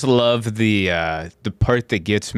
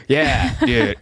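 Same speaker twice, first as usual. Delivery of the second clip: ordinary delivery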